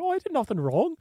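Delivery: put-on British accent. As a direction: imitate a protesting British kid. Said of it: "He's in his British accent".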